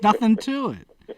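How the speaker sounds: laughing it out, slow and loud; amused disbelief through laughter; real laugh on the words. Real, not acted. Joking about ten stories a day.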